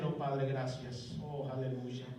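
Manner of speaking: low, slow, hushed thanks to the Father; hushed grateful prayer. Pretend. Believer thanking God in prayer, low, slow and hushed. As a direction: breathe it out like a reverent prayer.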